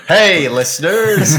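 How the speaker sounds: high, loud, drawn-out American radio-host holler; big Woody-style American cowboy greeting; American accent. Acted. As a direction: belt it out like an American toy cowboy. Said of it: "I don't know why you'd be American. It sounded like Woody from Toy Story".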